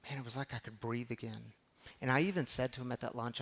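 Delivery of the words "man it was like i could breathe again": relieved hush. Real, not acted. Relief after tense lunch.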